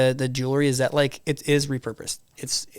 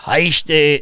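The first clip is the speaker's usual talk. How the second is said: bad Irish accent; 10 dB louder, slower than usual. Shown as a demonstration. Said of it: "can't even do an a-, Irish accent".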